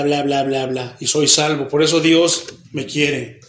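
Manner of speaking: ordinary delivery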